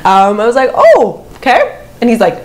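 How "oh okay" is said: very high, loud mock surprise; squeaky little acknowledgment, retold; awkward reaction. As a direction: replay the awkward moment way up high. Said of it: "I start to laugh, 'cause in awkward situations".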